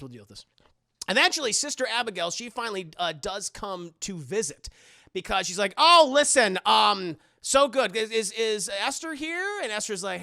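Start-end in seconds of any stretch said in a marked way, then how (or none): 0.0-0.5 much lower and much softer; menacing murmur. Retold or imitated. Esther plotting. 5.8-9.6 higher and louder, bubbly greeting; sweet visiting nun; cheery nun. Retold or imitated.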